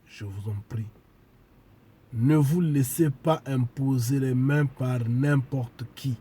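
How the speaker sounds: ordinary talk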